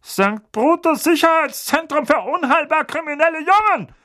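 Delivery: high, loud barked name. A narrator's reading. Uncle correcting Harry, barking. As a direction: bark it out in a panic. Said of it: "bellte Onkel Vernon".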